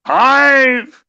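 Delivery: high, loud announcer count; dramatic countdown. Acted. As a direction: call out each number big.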